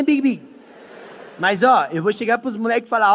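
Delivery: normal talking voice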